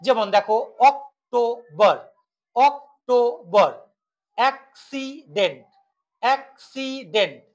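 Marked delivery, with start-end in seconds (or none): 0.9-3.7 অক্টোবর in three separated beats; broken into syllables. Shown as a demonstration. Teacher demonstrating trisyllables, অক্টোবর split in three. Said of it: "তিনটি syllable থাকে". 4.4-7.4 অ্যাক্সিডেন্ট in three separated beats; broken into syllables. Shown as a demonstration. Teacher demonstrating trisyllables, অ্যাক্সিডেন্ট split in three. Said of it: "তিনটি syllable থাকে".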